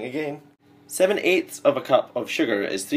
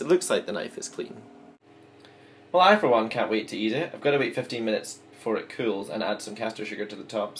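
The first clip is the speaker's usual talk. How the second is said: normal talking voice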